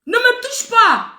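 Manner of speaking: high, loud and slow, spelled out; warning the girl off in the club, high and slow; forceful warning. Retold or imitated. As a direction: lay down the line, slowly and loudly. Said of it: "Je lui dis".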